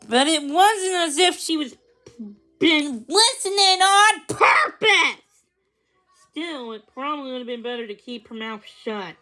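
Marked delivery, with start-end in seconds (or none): 0.1-5.2 indignant protest. A narrator's reading. Lizzie's defensive inner protest.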